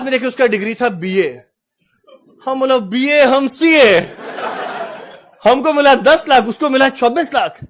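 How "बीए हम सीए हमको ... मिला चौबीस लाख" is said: high, loud, drawn-out indignation; mock outrage. CA fumes over BA earning more, loud mock outrage. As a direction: protest the injustice with hammed-up anger. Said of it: "हमको आया गुस्सा".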